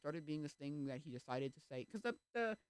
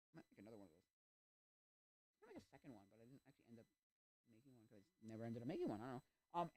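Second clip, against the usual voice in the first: normal talking voice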